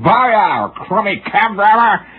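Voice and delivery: high, slow drawn-out insult; hollering insult. Retold or imitated. Pedestrian hollering at the cab driver, slow scornful insult. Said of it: "He's hollering".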